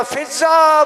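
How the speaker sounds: mourning reciter's half-voiced scream; retold cry for help. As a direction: call out "Fiza" as if crying for help. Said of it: "चिल्ला के कहा".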